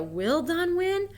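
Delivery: questioning. Shown as a demonstration. Teacher contrasting question with exclamation, asking. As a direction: ask it as a real question. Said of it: "so you change the wording".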